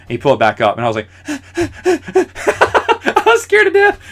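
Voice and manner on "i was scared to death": laughing high and slow through "I was scared to death". Real, not acted. Recalling the snake to his audience, laughing high and slow. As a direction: giggle through the words, much higher.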